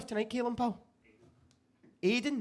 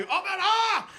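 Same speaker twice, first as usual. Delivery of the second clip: mock football shout; 9 semitones higher, 4 dB louder, slower than usual. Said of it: "Like, just shout".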